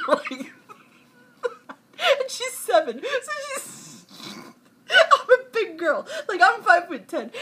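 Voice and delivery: high-pitched telling broken up by laughs; real helpless laughter. Real, not acted. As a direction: try to explain while cracking up. Laughing while retelling the scare.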